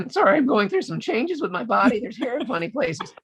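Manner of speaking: put-on squeaky high voice like a boy going through puberty; joking to the host about puberty changes, squeaky cracking voice; pubescent voice crack. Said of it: "Sorry, that was a weird little thing there".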